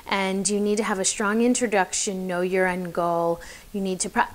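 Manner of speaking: mock reading off note cards, monotone; boring read-out. Shown as a demonstration. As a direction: drone through it like a dull script. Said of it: "boring. It's so boring".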